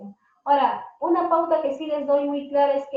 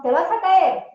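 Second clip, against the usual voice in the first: much louder and higher, a sharp shout of warning; alarmed warning; 5 semitones higher, 8 dB louder than usual. Shown as a demonstration. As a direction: yell it at a child about to fall. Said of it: "nosotros decimos".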